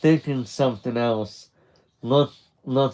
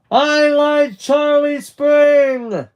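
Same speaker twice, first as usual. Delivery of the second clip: high, loud drawn-out yell; belted confession of a crush; shouted declaration; 9 dB louder, much slower than usual. Acted. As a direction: yell it slowly across the whole beach.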